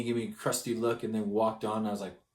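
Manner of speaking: ordinary talk, nothing marked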